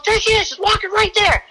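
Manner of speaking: high, urgent pointing out; excited. Retold or imitated. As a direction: call it out in a rush. Partner points her out.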